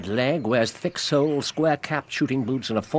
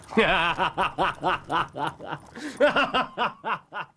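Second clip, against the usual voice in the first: a long, pleased laugh at his own quip; amused chuckle; 9 semitones higher than usual. Acted. Holmes laughing at Lestrade after his moonshine quip, pleased chuckle. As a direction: laugh off the inspector's scorn.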